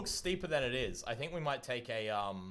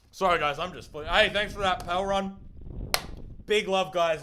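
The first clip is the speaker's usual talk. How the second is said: talking with a mouthful; mouth full; 5 semitones higher, 6 dB louder than usual. Real, not acted. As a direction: speak around a bite of ice cream. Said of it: "have a bite of ice cream".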